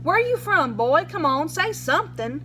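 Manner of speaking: a hint of a hard-nosed lawman grilling the boy; pushy sheriff.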